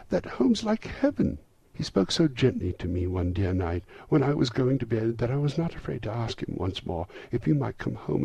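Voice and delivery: put-on high, eager child's voice; gleeful little girl. A narrator's reading. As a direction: pour out good news as a delighted little sister. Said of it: "brimful with glee".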